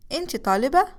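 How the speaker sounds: أنتِ طالبة؟ with the pitch rising on طالبة; question intonation. Shown as a demonstration. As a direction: ask أنتِ طالبة؟ with a clear rise on طالبة. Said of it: "it's an intonation thing".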